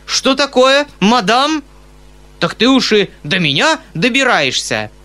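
a high, loud bellow; roaring. Acted. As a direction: roar it out in outrage. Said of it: "заревел толстяк".

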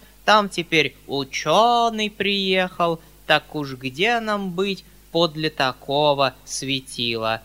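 lightly voiced servile old man, mocking; a hint of a sneering old servant; old man quoting. Old man mockingly retells a learned guest's refusal.

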